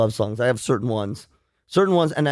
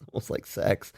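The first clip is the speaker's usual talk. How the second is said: embarrassed whisper; 7 semitones lower, 9 dB quieter than usual. Real, not acted. Embarrassed admission on air.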